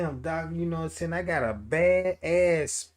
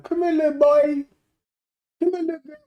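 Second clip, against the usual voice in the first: luring voice; 13 semitones higher, 9 dB louder, faster than usual. Retold or imitated. Dog luring kids, imitated.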